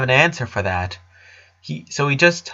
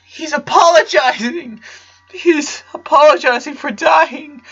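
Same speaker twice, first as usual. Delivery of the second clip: high, louder anguished wail; painful crying; 5 dB louder than usual. A narrator's reading. The father wails to his husband over the dying boy. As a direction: wail it out in grief. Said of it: "Howard cried painfully".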